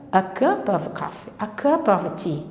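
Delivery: "a cup of coffee, a cup of tea" softly, with "a" as a faint "uh"; weakened schwa. Shown as a demonstration. Teacher showing the sound turning weak in phrases, schwa articles. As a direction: keep "a" and "of" weak, weight on the nouns. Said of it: "que torna-se fraco".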